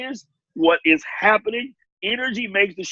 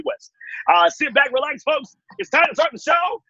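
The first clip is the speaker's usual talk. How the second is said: fast, bright announcer patter, pitched up; pre-show announcer; faster than usual. Retold or imitated. Backstage host warming up the audience, fast announcer patter. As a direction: hype the crowd from backstage like a quick-talking emcee. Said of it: "I literally sit backstage and I go".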